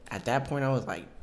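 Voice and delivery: normal talking voice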